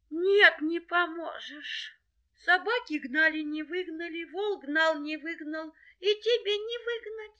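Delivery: very high, plaintive little voice; tearful doubting hare. Acted. Hare tells the helper it is useless, tearful. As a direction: lament hopelessly in a high voice.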